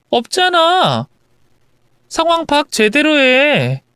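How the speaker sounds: lightly acted accusing wife; wronged wife confronting husband. Wife telling husband she knows everything, accusing. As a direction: lay out the charges against him.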